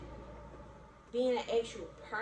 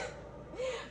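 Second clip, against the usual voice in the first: laugh tipping toward a cry; 13 semitones higher, 10 dB quieter than usual. Real, not acted. Laughing about the week of labor.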